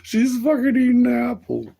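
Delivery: a squeaky amused cry; high squeaky voice. Acted. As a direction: squeal it through the giggles. High put-on voice while laughing.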